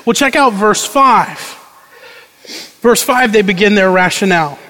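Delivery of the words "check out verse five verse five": ordinary delivery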